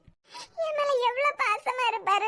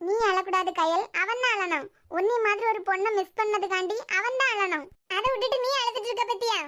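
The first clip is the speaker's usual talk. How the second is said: ordinary delivery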